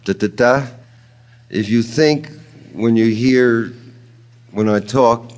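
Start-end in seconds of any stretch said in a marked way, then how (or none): 0.0-0.7 "Ta, ta, ta" with two short beats, then a long one; anapestic beat. Shown as a demonstration. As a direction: stress only the last "ta".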